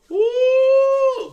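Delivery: loud high whoop; loud excited woo; hype yell. Real, not acted. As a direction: let out a big hype whoop. Hyping up a caller.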